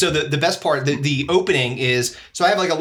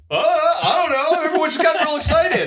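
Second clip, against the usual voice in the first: mock excited audience member; 11 semitones higher than usual. Front-row dude explaining himself to the comic, mock excited. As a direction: imitate an overexcited heckler, pitched way up. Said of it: "He's like".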